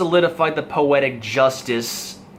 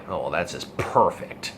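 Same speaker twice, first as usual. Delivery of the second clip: low, soft, completely deadpan; McCoy deadpan; 10 semitones lower, 6 dB quieter than usual. Retold or imitated. McCoy after Spock beams up without him, completely deadpan. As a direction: drop it low with no enthusiasm. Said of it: "McCoy says completely deadpan".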